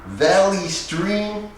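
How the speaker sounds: slow, high disbelieving echo of the town; lightly voiced Joe Pesci, slow and doubting; incredulous Pesci. A narrator's reading. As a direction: repeat it slowly as if it cannot be real. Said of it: "he said, shaking his head".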